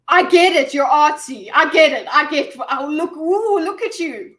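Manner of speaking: loud, high mocking "I get it"; mock the film's showing off; sarcastic mocking. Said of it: "be like".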